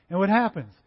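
ordinary talk

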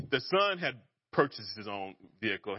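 normal talking voice

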